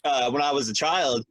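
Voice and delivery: ordinary talk, nothing marked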